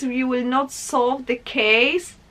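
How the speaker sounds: ordinary talk, nothing marked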